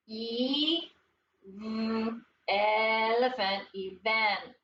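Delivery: "E, v, elephant, event" with each sound held separately, then blended. Shown as a demonstration. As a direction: stretch E and v apart, then say "event". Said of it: "Sound it out".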